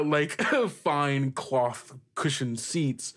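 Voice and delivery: ordinary delivery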